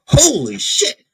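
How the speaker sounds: high-pitched startled exclamation; mock alarm. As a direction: react as if a snake just rattled. Startled reaction to a rattle.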